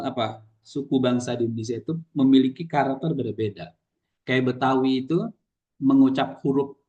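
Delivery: ordinary delivery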